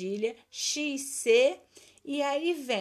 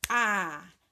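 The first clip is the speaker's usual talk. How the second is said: "Agasalho" as a, ga, sa, lho, each held long; long gaps between stretched syllables; 4 semitones lower, faster than usual. Shown as a demonstration. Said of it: "Separa as sílabas".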